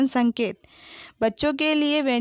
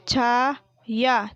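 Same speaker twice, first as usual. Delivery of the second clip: Chhaya split into long chhaa and yaa; slow Chhaya, syllables held apart; spelled out; much slower than usual. Shown as a demonstration. Teacher sounding out Chhaya for pupils, syllable by syllable. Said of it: "मैंने इस तरीके से पढ़ा".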